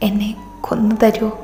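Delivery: a broken, whispered plea; pleading whisper. Acted. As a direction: beg for it in a hushed whisper. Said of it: "യാചനയോട് ചോദിച്ചു". (യാചിക്കുന്ന മന്ത്രണം)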